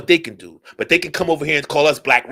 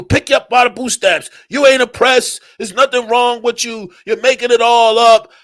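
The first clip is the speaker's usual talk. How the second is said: high, raised imitation of a preachy critic; mock scolding lecturer; 8 semitones higher than usual. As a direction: mimic him lecturing, pitched well up.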